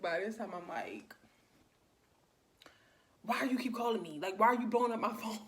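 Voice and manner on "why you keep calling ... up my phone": higher, exasperated retold complaint; mad. Finally answering the repeat caller, mad and exasperated. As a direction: demand an answer, voice raised toward the end. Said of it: "by this time I'm mad".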